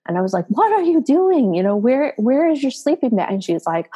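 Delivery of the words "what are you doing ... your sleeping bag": impatient. Retold or imitated. Impatient mom to daughter holding up the car, exasperated. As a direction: demand an answer like an impatient parent. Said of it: "getting more impatient".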